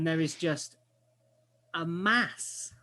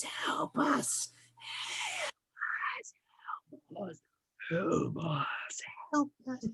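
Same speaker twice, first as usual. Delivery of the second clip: different eerie voices pleading; whispery monster mouths begging, shifting in pitch; many-mouthed creature; 5 semitones higher, 4 dB quieter than usual. Acted. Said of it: "more mouths appear and speaking different voice".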